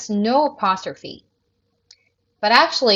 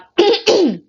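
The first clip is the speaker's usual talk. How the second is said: throat clearing; 12 semitones higher, 6 dB louder than usual. Real, not acted. Clears throat mid-sentence.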